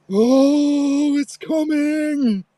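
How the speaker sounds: very high, loud, slow wail; hammed-up death wail. Injured boy milking his injury, high loud drawn-out wail. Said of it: "He's like".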